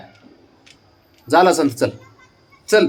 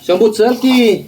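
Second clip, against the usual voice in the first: calling out; 8 semitones higher, 6 dB louder, slower than usual. Real, not acted. Calling dog over.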